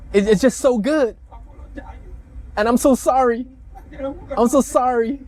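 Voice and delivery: mocking voiceover of a sorry official; fake-dub him as a whiny apologizer; sarcastic mock apology in his voice; mock translation. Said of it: "I'll translate for you".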